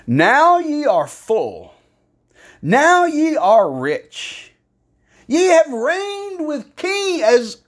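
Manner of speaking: slow, high-pitched mocking praise; sarcastic. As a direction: draw it out in exaggerated sarcasm. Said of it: "Paul's getting sarcastic here".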